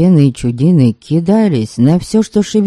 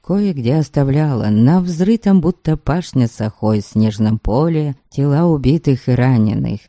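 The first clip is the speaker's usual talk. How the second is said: ordinary delivery, nothing marked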